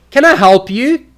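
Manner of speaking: high, loud, irritated challenge; bothered. Shown as a demonstration. To someone bothering you, loudly irritated. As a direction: ask it sharply as if interrupted. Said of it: "you are bothering me".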